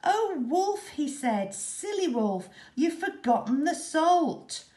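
high, loud teasing tut; mock scolding. A narrator's reading. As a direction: scold the silly wolf grandly. Boy teasing the wolf.

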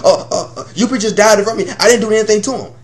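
high, fast, frightened babble; squeaky nervous rush of excuses; panicky blabbering. Retold or imitated. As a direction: gush out excuses like a scared snitch. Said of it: "he started almost singing like damn canary".

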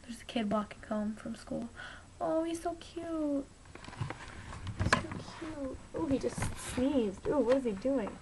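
hushed, cooing aside; hushed whisper. Real, not acted. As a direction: whisper as if watching quietly. Watching kid outside, hushed.

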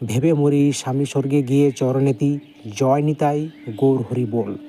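slow recitation, each word spelled out gently; slow, careful recitation. Shown as a demonstration. Teacher reciting the mantra for viewers to learn, slow and careful. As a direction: ease through the mantra slowly so viewers can follow. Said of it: "আমি হালকা হালকাভাবে উচ্চারণ করে শুনে দিচ্ছি".

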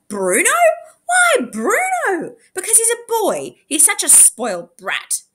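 high, wailing sibling outrage; scowling protest. A narrator's reading. Clancy and Tash united against Bruno going, indignant protest. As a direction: whine indignantly about the favoured brother. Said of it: "Clancy scowled at her brother".

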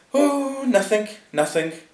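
very high, airy brush-off; high lordly "never mind"; dismissive lord. A narrator's reading. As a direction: wave it away in the lord's high voice. Lord brushes off his remark.

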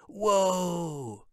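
high, drawn-out awed cry; wowed onlooker, pitch sliding down; impressed whoa. A narrator's reading. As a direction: let out a long, amazed whoa. Onlookers impressed by kite tricks.

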